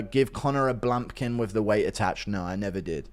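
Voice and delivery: ordinary talk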